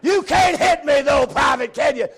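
screaming, slow taunt; drill sergeant shout. Retold or imitated. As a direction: taunt the recruit at full volume.

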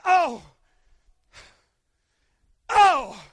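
loud, drawn-out mock moan of pain; mock pained wail. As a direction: ham up the splinter pain. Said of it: "And I went".

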